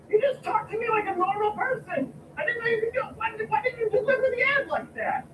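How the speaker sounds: exasperated yell. Acted. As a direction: shout it like a fed-up boss. Boss yelling at employee.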